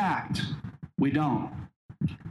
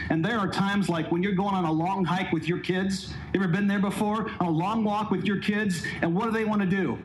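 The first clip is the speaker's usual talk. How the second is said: usual speaking voice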